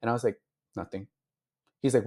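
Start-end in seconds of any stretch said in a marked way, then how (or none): 0.8-1.1 barely audible, sullen nothing; soft withdrawn reply; quiet brush-off. Retold or imitated. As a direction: mumble it away while upset. Crying, brushing off the CEO.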